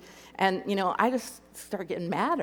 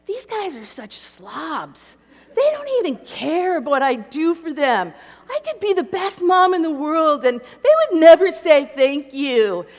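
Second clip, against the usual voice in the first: rising, louder and higher into a bitter outburst; angry rant; 7 semitones higher, 8 dB louder than usual. Retold or imitated. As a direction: vent at the family, building to a shout. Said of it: "I'm like".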